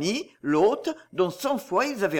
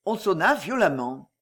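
ordinary delivery

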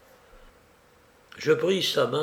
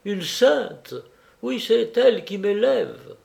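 higher-pitched, fervent praise; tender fervour; 7 semitones higher, 4 dB louder, slower than usual. A narrator's reading. Felipe praises his sister to the guest, with tender fervour. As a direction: let devotion lift the voice. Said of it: "avec un élan de terre ferveur".

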